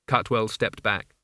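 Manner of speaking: plain reading voice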